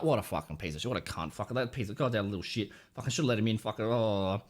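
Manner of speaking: low, seething string of curses; mad under the breath. Retold or imitated. Driver who got no thank-you wave, privately mad. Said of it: "I'm just mad in my brain".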